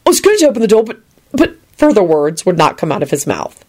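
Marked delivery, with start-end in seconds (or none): 0.1-1.5 high, nervous excuse; flustered babbling. A narrator's reading. Neville fails to explain himself, flustered babbling. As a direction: stumble over it in a higher voice. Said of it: "he babbled quickly".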